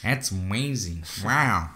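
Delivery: lightly imitated amazed actor; a hint of celebrity impression, drawn-out wow; Owen Wilson. Podcaster carries on mimicking the actor, mild drawn-out amazement. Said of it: "use the Owen Wilson vo".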